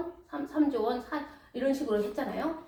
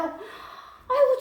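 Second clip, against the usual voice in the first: pleading debtor; 4 semitones higher than usual. Acted. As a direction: beg the master like a desperate servant. Debtor begs master for mercy.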